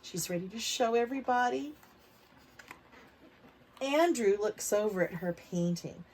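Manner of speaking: usual speaking voice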